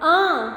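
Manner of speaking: teacher shows the nasal आ; nasal aa demo. Shown as a demonstration. Teacher demonstrating the nasal vowel to students. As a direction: open mouth, sound through the nose. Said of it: "जैसे देखो".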